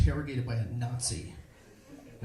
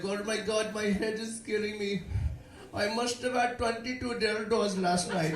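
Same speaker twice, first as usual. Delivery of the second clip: higher, mock Indian roommate complaining; higher put-on Indian accent; 7 semitones higher than usual. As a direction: hungover Indian roommate moaning about last night. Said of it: "he would say things like".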